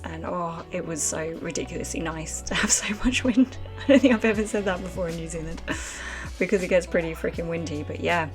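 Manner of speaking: ordinary delivery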